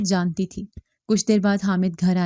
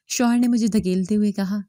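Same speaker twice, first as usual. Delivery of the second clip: ordinary delivery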